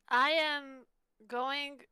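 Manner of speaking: ordinary delivery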